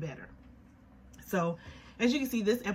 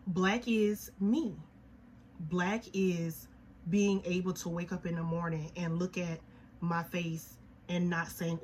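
ordinary talk

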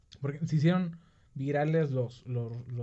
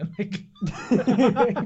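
slow, high, laughing; mock meme line with laughter; laughing quote; 9 semitones higher, 5 dB louder, much slower than usual. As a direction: stretch it out laughing. Quoting with laugh.

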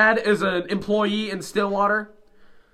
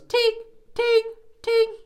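high-pitched sung "ting ting"; high ting sound. Retold or imitated. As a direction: imitate a triangle ting. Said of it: "He goes".

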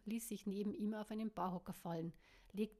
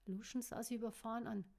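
ordinary delivery

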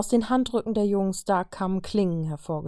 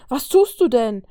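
alarmed shout, higher and much louder; cursing shout; 5 semitones higher, 9 dB louder than usual. A narrator's reading. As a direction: yell at her in shock. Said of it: "fluchte Toni".

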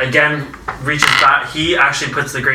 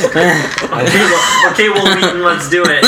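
higher, laughing through words; 5 semitones higher, 3 dB louder than usual. Real, not acted. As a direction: giggle it out. DM laughing at table.

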